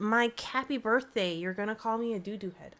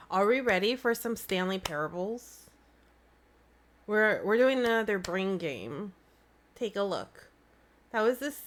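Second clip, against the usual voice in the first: normal talking voice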